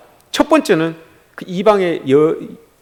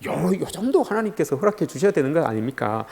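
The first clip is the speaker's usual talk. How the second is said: much softer, wheedling plea; minimizing request; 10 dB quieter than usual. Acted. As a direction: make the favour sound tiny, voice dropped. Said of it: "그렇게 생각해요".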